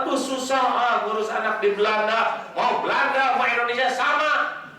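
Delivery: mock a parent moaning about how hard it is; complaining parent.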